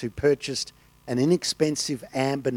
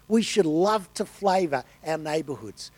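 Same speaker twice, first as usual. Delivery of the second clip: ordinary talk, nothing marked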